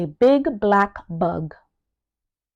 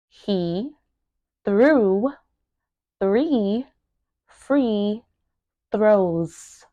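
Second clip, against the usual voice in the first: word by word; much slower than usual. Shown as a demonstration. Teacher breaking down a tongue twister, word by word. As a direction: separate words with long pauses. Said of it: "make sure that you are pronouncing each word".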